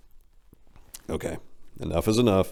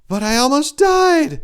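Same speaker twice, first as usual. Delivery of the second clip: high, slow pleading bird voice; 8 dB louder than usual. Acted. Big bird pleading with the narrator, high and slow. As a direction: beg in a small high bird voice. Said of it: "she said pleadingly".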